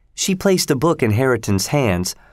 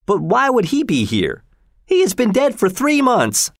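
high-pitched, astonished reply; taken aback, climbing higher as it goes; surprised; 10 semitones higher than usual. A narrator's reading. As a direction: explain in surprise, voice lifted well up.

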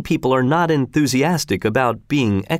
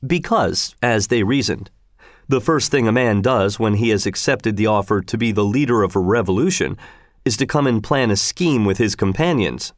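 usual speaking voice

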